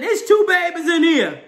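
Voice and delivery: high, loud shocked exclamation through laughter; shocked discovery. Retold or imitated. Husband spotting baby B on ultrasound, high loud shock. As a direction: blurt out the shock. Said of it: "And then I said".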